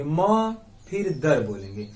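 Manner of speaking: drawn-out म, then दर; two separate parts. Shown as a demonstration. Teacher splitting the word for students, slow syllable break. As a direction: slowly separate म from दर. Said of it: "दो part में बोलेंगे".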